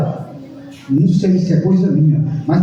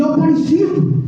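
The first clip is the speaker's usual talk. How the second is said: higher-pitched calling voice, imitated; voz misteriosa chamando; 7 semitones higher than usual. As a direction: imitate a voice calling someone by name from above. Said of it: "aí uma voz falou assim". (voz que chama, imitada em tom mais agudo)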